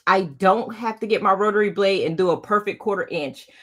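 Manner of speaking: ordinary talk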